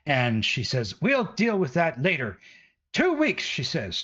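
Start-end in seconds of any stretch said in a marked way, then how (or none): 1.0-3.4 higher, scolding mother's voice; angry village mother. Acted. Mother with a broom berating the adventurers, high and scolding. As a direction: scold them like an outraged mother.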